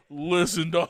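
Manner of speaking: normal talking voice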